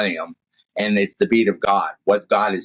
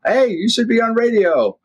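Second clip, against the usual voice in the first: much higher, put-on eager fan voice; hammed-up admiring listener, pitched way up; imitating listeners; 14 semitones higher than usual. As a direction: chirp it like a starstruck stranger. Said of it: "people always will hear me and they go".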